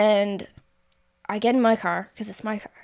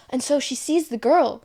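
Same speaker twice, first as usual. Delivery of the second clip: normal talking voice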